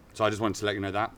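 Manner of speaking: usual speaking voice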